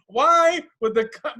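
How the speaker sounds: ordinary talk, nothing marked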